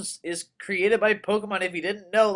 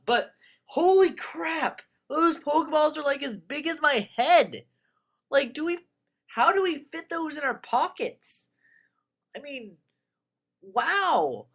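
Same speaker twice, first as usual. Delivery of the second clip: amazed; 10 semitones higher than usual. Real, not acted. Amazed at huge Pokeballs.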